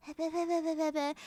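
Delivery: high silly babbling; babble noise. Shown as a demonstration. Tired streamer demoing a sudden babble. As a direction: let out a playful brain-melted babble. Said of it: "if I just suddenly go".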